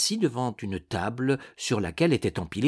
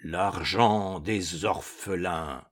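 slow, low, soft mournful ghost; lugubrious ghost voice; 6 semitones lower, 4 dB quieter, much slower than usual. A narrator's reading. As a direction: intone it gloomily. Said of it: "tout en proférant lugubrement".

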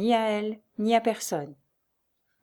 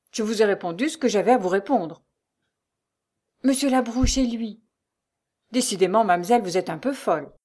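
louder, firm rebuff; brusque, dismissive; 3 semitones higher, 5 dB louder than usual. A narrator's reading. Concierge refusing to answer Lucie, brusque. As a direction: shut the caller down brusquely. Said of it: "Le ton brutal".

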